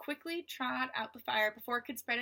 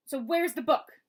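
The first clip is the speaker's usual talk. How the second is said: menacing demand; 3 semitones higher, 9 dB louder than usual. Acted. Villain demands book.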